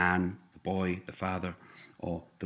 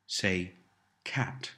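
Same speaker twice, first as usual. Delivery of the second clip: ordinary talk, nothing marked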